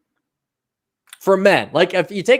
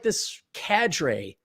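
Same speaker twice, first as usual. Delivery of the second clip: normal talking voice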